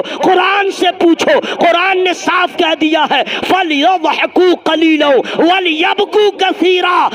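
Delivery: loud, high, drawn-out sermon cry; impassioned shouted preaching. Real, not acted. Preacher shouting to the gathering, impassioned sermon voice. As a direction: shout it to the crowd like a fervent preacher.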